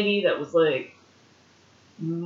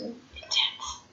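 breathy whisper; 8 dB quieter than usual. Pretend. Echoing word, unvoiced high.

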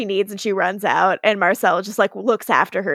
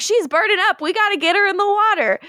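higher, fast urgent quote; urgent Elijah; 9 semitones higher, faster than usual. Retold or imitated. Elijah panicking as Hope burns up, fast and high. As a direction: rush it up high like a worried man. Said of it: "so Elijah's like".

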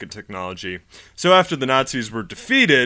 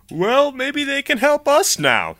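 mock official voice; 4 dB louder, slower than usual. Americans voiced justifying Nazi scientists.

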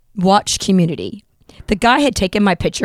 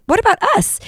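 indignant what about us; 11 semitones higher than usual. Retold or imitated. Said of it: "And we're like".

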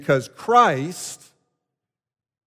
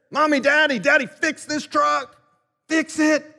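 higher, whiny mock wail of a small kid; crying little boy; 9 semitones higher than usual. Eight-year-old begs his parents to fix the truck, whining. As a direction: plead like a boy who broke his toy. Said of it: "screams to his parents".

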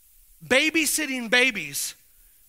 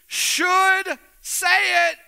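slow, loud and higher; drawn-out call; 6 semitones higher, 5 dB louder, much slower than usual. Real, not acted. Preacher prompting the congregation, slow loud call. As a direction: stretch it out and call it to the room.

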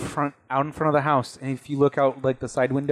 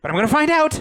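put-on determined kid voice; 6 dB louder, faster than usual. Kid character vows determinedly.

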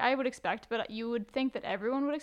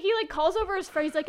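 ordinary delivery

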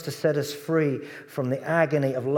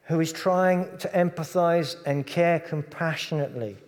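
ordinary delivery

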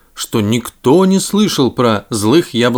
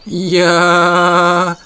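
slow, drawn-out snail voice; 4 semitones higher than usual. Acted. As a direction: stretch the word lazily like a snail. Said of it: "медленно говорят".

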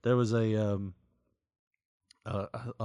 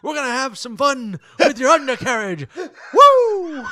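high, loud hollering chase; wild whooping; 12 dB louder, slower than usual. Pretend. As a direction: whoop like a maniac giving chase.

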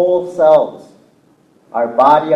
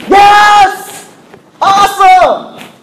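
high, loud cheer; excited shout; 8 dB louder, much slower than usual. Acted. Preacher mimics shouting at the Seahawks game, exultant. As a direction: yell it like a fan at the big game. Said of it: "when's the last time you shouted?".

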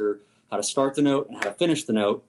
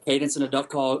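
normal talking voice

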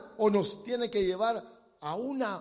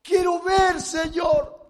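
slow, loud, high shouted cry; desperate shout; 9 semitones higher, 11 dB louder, slower than usual. Retold or imitated. Blind man shouting past the crowd to Jesus, loud desperate cry. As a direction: yell over the crowd to be heard. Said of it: "Yo creo que iba llorando".

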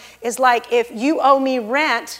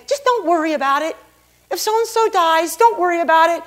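higher-pitched mock reassurance; breezy brush-off; 5 semitones higher than usual. What God did not say to the worrier, mock breezy brush-off. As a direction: toss it off lightly in a higher voice. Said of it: "He didn't say".

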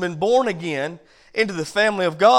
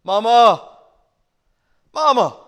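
imitating a boy hollering for his mother; loud, insistent acted call to another room; 3 semitones higher, 9 dB louder, slower than usual. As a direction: call downstairs for Mama, louder each time. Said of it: "he calls out and says".

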